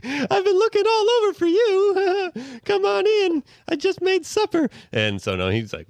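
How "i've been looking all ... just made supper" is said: high-pitched put-on housewife voice; very high mock wifely greeting; doting wife. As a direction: play an overly sweet wife greeting her husband. Voices wife calling husband in.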